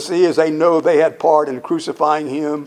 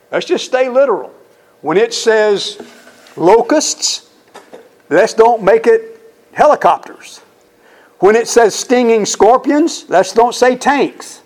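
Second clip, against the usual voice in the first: emphatic exhortation; 7 semitones higher than usual. Acted.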